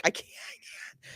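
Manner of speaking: breathless whisper of disbelief. Retold or imitated. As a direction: whisper it, overwhelmed. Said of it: "I'm just like".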